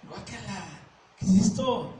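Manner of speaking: un guácala asqueado y exagerado; asco fingido. Acted. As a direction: rechaza el plato con asco teatral. Rechazo asqueado actuado. (asco exagerado al ver la comida)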